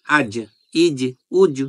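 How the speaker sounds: আজ, ইজ, উজ with the final জ bounced out hard; qalqala bounce. Shown as a demonstration. Teacher demonstrating the qalqala bounce on জ. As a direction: jolt the closing জ on আজ, ইজ, উজ. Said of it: "ধাক্কা দেওয়ার চেষ্টা করব".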